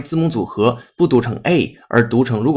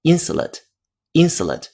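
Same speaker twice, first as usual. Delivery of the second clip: American "insulate", middle reduced to a short "suh"; American pronunciation; 5 semitones higher than usual. Shown as a demonstration. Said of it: "但是如果美音的话".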